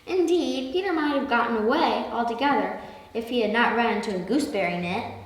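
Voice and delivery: plain reading voice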